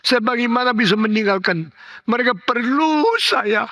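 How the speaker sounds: high, pressing lament; anguished plea. Retold or imitated. Dying father asking how he can leave his family, anguished plea. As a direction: plead for those who depend on you.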